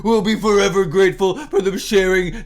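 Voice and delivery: exaggerated false gratitude; mock-sincere rereading. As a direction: restart the line, laying it on thick. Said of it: "with utter contempt".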